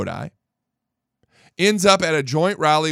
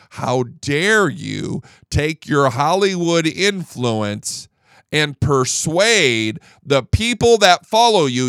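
usual speaking voice

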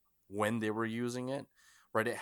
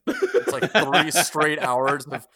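laughing; 5 semitones higher, 14 dB louder, much slower than usual. Real, not acted. Joking about long film.

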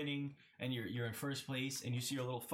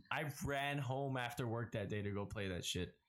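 ordinary delivery

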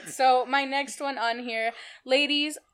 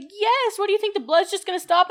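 exasperated mom; 9 semitones higher, 6 dB louder than usual. Retold or imitated. Mom answering incredulous.

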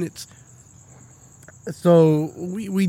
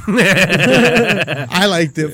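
louder, laughing through the words; 7 dB louder, much slower than usual. Real, not acted. Telling friends about the lube, laughing. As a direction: crack up while admitting it.

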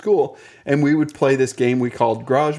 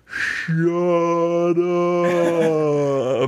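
slow motion; 2 semitones higher than usual. Retold or imitated. Kid turning over mid-fight, in slow motion. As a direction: stretch every sound as if time slowed. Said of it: "like, in slow motion".

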